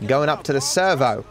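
attempted Aussie accent; Australian accent. Shown as a demonstration. Gamer testing himself for viewers, attempted Australian accent. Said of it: "Can I do an Australian accent?".

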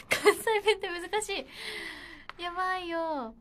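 loud, high, laughing despair; flustered outcry. Real, not acted. Idol giving up on the Kansai dialect task, loud flustered cry. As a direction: wail it out as you give up.

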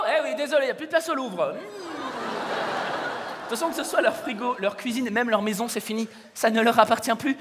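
ordinary talk, nothing marked

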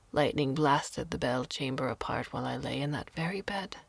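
put-on old hermit telling Carlo about the ruined tower, low whisper; hushed old woman. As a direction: let each word come from long silence. Old woman speaks of silence, ruins.

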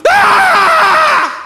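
long, very high, full-force mock scream; terrified scream. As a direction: scream all the way down. Screaming while dying in plane.

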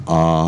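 long "ah" with dropped jaw; teacher's model vowel "ah"; open-mouth "ah". Shown as a demonstration. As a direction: open wide and sustain "ah". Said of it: "Just open mouth".

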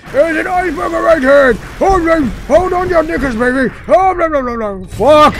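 high, shouted mock-British panic; British accent. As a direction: yell like a panicking British pilot, breaking into babble. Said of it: "Why would a British person call Houston?".

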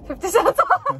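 high, loud teasing repeat; mocking echo. Real, not acted. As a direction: throw the words back mockingly. Mocking the discount excuse.

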